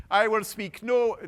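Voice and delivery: attempted Irish accent. Retelling the prisoner's refusal, abandoned attempt at Irish accent. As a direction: have a brief, half-hearted go at Irish. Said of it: "he said it in an Irish accent".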